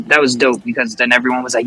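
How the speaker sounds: normal talking voice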